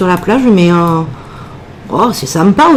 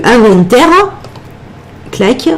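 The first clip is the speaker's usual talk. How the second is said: usual speaking voice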